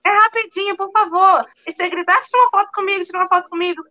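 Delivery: high, pleading retold request; coaxing request. Actress asking teenagers to film her, coaxing. Acts out asking teens a favour.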